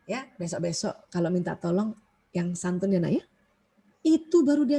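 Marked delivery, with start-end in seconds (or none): 0.1-3.2 soft, much quieter motherly reminder; gentle advice. Retold or imitated. Mother softly telling her child to ask politely, gentle. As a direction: coax the child kindly.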